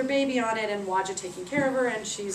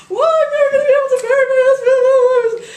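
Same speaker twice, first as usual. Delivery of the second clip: high, loud hammed-up wail; mock wailing; 15 dB louder than usual. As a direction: wail like a grieving widow. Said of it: "she starts to cry".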